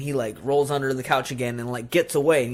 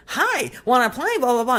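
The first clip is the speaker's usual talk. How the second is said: high, louder chirpy doll greeting; Chucky doll voice; 12 semitones higher, 5 dB louder than usual. Retold or imitated. The doll turns its head toward Karen, high chirpy toy voice. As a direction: squeak it out like a talking toy.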